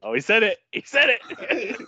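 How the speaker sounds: high, loud delighted crowing; gleeful teasing. Real, not acted. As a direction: crow in triumph, stretching the last words. Teasing Liam for saying the phrase.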